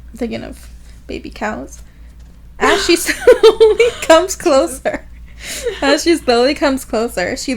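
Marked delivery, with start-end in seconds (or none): 2.6-5.0 high, loud reading broken by laughter; laughing. Real, not acted. Host reading the woman's approach, losing it laughing. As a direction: crack up on the slow words.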